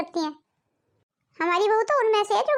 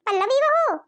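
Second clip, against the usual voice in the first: higher, louder second call across the house; 5 semitones higher, 4 dB louder than usual. Acted. Mother-in-law calls the bahu again from afar, louder and higher. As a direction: call her again, this time raising the voice.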